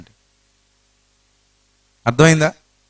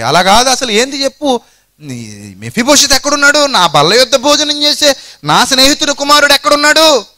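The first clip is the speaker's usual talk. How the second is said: king demanding; 8 semitones higher than usual. Acted. King David demands an answer.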